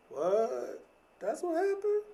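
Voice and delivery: high-pitched astonished reaction; mock surprise. Speaker imitates reacting to other people's drama, mock surprise. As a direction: voice shoots up in feigned disbelief.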